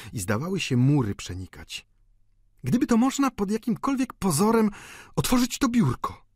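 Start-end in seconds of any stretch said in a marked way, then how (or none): 2.6-6.3 very high, eager woman's voice; chciwa stara panna. A narrator's reading. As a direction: scheme keenly, pitched high. (wysoki, pożądliwy głos starej panny)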